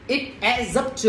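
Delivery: "It adds up to" with the s of "adds" voiced and joined to "up"; linked s ending. Shown as a demonstration. Teacher explaining the added S, "adds up" linked as "ad-zup". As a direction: run "adds up" together as "ad-zup" in "It adds up to". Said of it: "thầy đọc là adds up".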